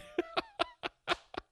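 high, helpless real laughter; laughing. Real, not acted. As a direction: crack up at your own admission. Cracks up at own realization.

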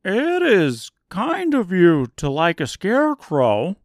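high, loud, eager scarecrow; bright straw man bursting with pride. Acted. Scarecrow boasting of new brain.

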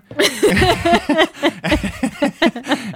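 high giggling burst; high embarrassed laugh. Real, not acted. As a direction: laugh at your own awkwardness. Remembering kissing her chin.

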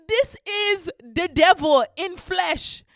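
loud, slow, high denunciation; dramatic outrage, each word drawn out; horrified shout. Retold or imitated. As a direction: cry it out like a dire warning. Acted horror at the cat.

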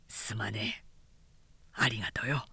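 whispered, almost breathless thanks; soft whisper. A narrator's reading. Kanjiro whispering his thanks to Onatsu.

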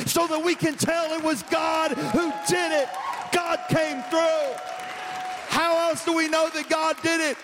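high, full-force preaching shout; real preacher screaming. Real, not acted. Preacher urges congregation to pray specific prayers, screaming. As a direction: cry it out from the soapbox. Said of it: "I'm, I'm screaming a lot".

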